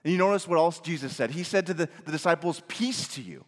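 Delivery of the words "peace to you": soft, lower, tender blessing; gentle. Pretend. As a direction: offer peace quietly. Jesus's gentle words quoted.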